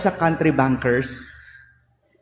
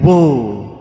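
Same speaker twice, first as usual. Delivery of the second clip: calling out; 5 semitones lower, 5 dB louder than usual. Shown as a demonstration.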